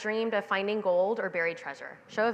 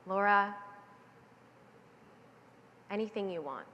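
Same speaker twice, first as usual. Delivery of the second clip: softer, tender motherly reassurance; loving mom; 4 dB quieter than usual. Retold or imitated. Mother hovering over her daughter, soft and loving. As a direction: say it gently like a mother looking down with love. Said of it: "and she says".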